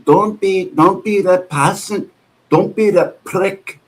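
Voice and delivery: high, slow Scottish-accent imitation; drawn-out, high-pitched mock Scottish accent; Nicola's accent. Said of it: "The other day she said that".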